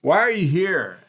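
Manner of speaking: loud, high-pitched demand; calling out challenge. Acted. Lookout questions the visitor from behind the flashlights, calling out. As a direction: shout the question across at them.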